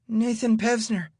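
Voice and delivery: put-on high, nervous quivering boy; a scared kid stammering out his own name. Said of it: "in a quivering voice".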